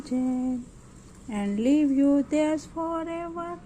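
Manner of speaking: reading a rhyming poem aloud line by line for pupils; teacher modelling a children's poem to copy; poem recitation. Shown as a demonstration.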